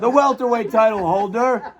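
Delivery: announcer call breaking into laughter; ring announcer voice. Pretend. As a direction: hype the title with a laugh. Mock boxing ring introduction.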